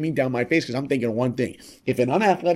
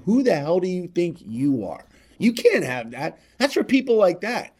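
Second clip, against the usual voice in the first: shoulder devil voice; 5 semitones higher, 3 dB louder than usual. Retold or imitated. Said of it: "that shoulder devil whispering into our ear".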